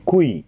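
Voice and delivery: クイ starting on a clear ウ then moving to イ; model クイ. Shown as a demonstration. Teacher demonstrating the qui sound. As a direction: round the ウ, then slide to イ. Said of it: "このクイって音の発音するときに".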